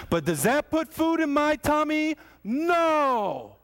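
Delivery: high, loud whining complaint; whiny hungry friend. Retold or imitated. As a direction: wail it like a starving friend in traffic. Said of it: "Eric summed it up beautifully".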